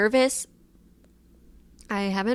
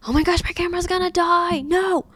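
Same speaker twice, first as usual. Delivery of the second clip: high, louder outburst of alarm; real sudden panic; 9 semitones higher, 5 dB louder than usual. Real, not acted. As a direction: cry out in dismay at the camera. Camera battery dying mid-recording.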